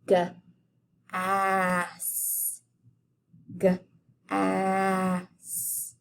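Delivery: G, ass split into onset and vowel; segmented g-ass. Shown as a demonstration. Teacher sounding out gas for kids. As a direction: stretch G and ass apart to show the middle vowel. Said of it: "let's go for that middle vowel sound".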